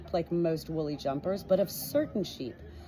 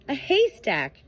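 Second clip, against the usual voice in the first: a touch higher and louder sheep voice; Cordelia suggesting; 6 semitones higher, 6 dB louder, slower than usual. Acted. Cordelia suggesting a haystack, lightly voiced. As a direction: offer it up hopefully.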